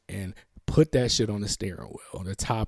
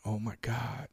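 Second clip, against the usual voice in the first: whispered prayer; 8 dB quieter than usual. Retold or imitated. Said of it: "and I'm praying".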